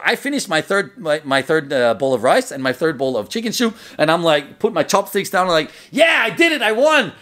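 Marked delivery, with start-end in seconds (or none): 5.9-7.1 high-pitched victory whoop; triumphant cry. Retold or imitated. Eater thinking he won the dinner, triumphant high cry. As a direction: crow it like you just won.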